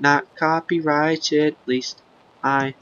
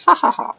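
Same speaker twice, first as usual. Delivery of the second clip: very high silly laugh; cartoon chuckle; high mock laugh; 13 semitones higher, faster than usual. Gamer laughing in his high silly voice.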